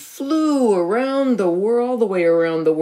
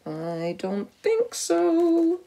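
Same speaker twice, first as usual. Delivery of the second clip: slow, high, drawn-out no; knowing doubt; 10 semitones higher, much slower than usual. Real, not acted. Doubting Elizabeth still loves him.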